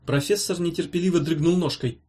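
plain reading voice

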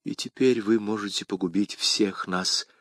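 soft, hushed warning; вполголоса. A narrator's reading. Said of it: "вполголоса проговорила". (тихое предостережение вполголоса)

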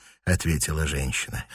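usual speaking voice

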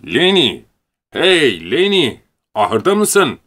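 loud, high call, drawn out on "Lenny". Acted. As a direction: shout into the barn. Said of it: "bir ses bağırdı".

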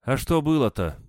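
high-pitched, dismissive woman's question; unwilling to go into it; reluctant. A narrator's reading. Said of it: "нехотя ответила женщина". (нехотя)

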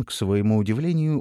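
ordinary delivery, nothing marked

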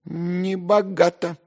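lofty, affected condescension; self-important remark. A narrator's reading. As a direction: stretch it out pompously. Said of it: "важно заметил Зверков".

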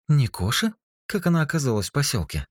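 high, quick surprised female voice. A narrator's reading. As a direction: gasp out the question in surprise. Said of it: "удивилась И И".

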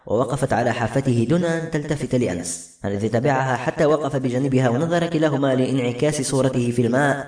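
plain reading voice

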